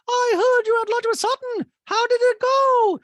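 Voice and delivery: mock Dorit gossiping at the dinner table; high squeaky gossip. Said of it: "is whispering to Diana".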